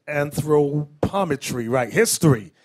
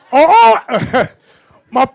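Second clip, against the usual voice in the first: loud high burst of laughter through the words; laughing; 7 semitones higher, 11 dB louder, slower than usual. Real, not acted. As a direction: crack up while trying to talk. Bursts out laughing mid-sentence.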